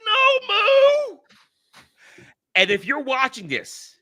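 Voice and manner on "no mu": high, loud anguished shout of a name; anguished cry. Retold or imitated. A fan reliving Mu's death, anguished shout. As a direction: cry out as the hero dies. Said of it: "and you're like".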